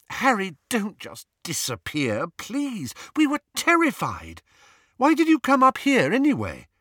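put-on high-pitched, anxious girl's reproach; plead, then scold, voice rising high; worried scolding. A narrator's reading. Hermione to Harry after fearing he'd vanished, worried scolding.

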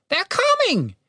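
very high, urgent warning; alarmed raven. A narrator's reading. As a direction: rush out a warning in a high bird voice. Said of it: "with alarm in its voice".

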